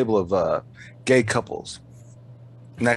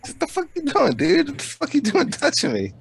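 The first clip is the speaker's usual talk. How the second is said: higher, hammed-up indignant yell; imitating an offended guy, much higher; mock outrage, pitched way up; mock angry stranger; 11 semitones higher than usual. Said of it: "He's like".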